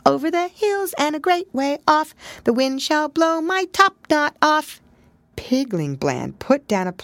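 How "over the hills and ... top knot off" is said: high, tiny hidden voice singing; a little voice chiming in from the cupboard; small smothered voice. Acted. Unseen pig chimes in from the locked cupboard. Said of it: "a little smothered voice chimed in".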